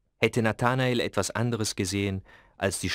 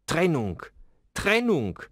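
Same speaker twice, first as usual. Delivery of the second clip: rising desperate cry, second much higher; wild cry; 8 semitones higher than usual. A narrator's reading. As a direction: cry it out wildly, pitch climbing. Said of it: "schrie er ganz wild". (wilder, verzweifelter Aufschrei)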